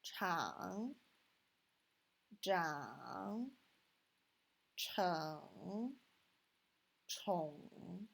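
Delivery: "Chang", "zhang", "cheng", "chong" each dipping in the third tone; third tone drill. Shown as a demonstration. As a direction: dip down and back up on each syllable. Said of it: "third tone".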